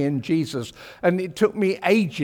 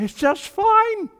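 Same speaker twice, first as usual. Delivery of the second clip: high, slow, airy mock voice; breezy churchgoer; 4 dB louder, slower than usual. Preacher mimics an easygoing churchgoer, high airy mock voice. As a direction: float it up high and easy.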